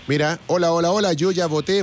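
ordinary talk

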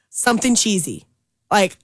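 ordinary delivery

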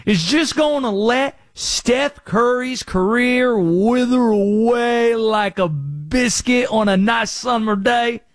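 a conspiracy caller with a put-on Southern accent, ranting; Conspiracy Josh Southern accent. Radio host in his Conspiracy Josh bit, theatrical Southern accent. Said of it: "Where did this accent come from".

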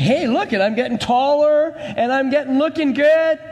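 high-pitched, showing-off lump of clay; preacher playing a vain clay pot; boastful pot of clay. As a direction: brag in a high, pleased-with-yourself voice. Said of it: "then he starts saying".

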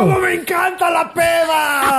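grito agudísimo y arrastrado, en broma; grito de borracho. Acted. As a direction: imita al borracho eufórico, voz muy aguda. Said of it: "Y gritas". (alarido festivo fingido)

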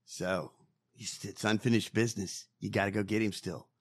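ordinary talk

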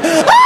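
high, loud mock scream; panicked shriek. Driver panicking at the police, loud shriek. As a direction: let out an unmanly shriek. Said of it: "it brought the bitch out".

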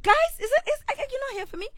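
high-pitched, hurried plea; mock pleading. Host acting out begging the show's listeners, mock pleading. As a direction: plead in a put-upon, high voice.

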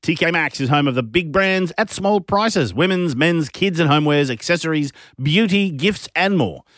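bright, upbeat radio ad voice; ad read. Acted. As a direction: sell it like a radio announcer. Live ad read.

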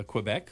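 Quebec said as kwuh-BECK; Quebec the anglicized way; American pronunciation. Shown as a demonstration. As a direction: begin Quebec with "kw". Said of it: "we say".

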